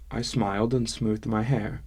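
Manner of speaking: plain reading voice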